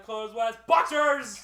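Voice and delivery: "boxers" loud, high and drawn out; exclaimed reveal. Retold or imitated. Telling how friend's clothes vanished, shouted punchline. Comic reveal of sudden undressing.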